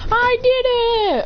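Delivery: high victory cry; triumphant cheer. Acted. Fighter celebrating his win, high triumphant cheer.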